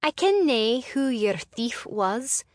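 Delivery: Scots, voice catching with emotion; Scottish accent, emotional. Acted. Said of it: "her throat scratchy with emotion".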